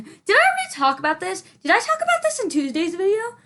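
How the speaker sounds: high, startled question; surprised. Real, not acted. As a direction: suddenly realize you repeated yourself. Sudden worried question.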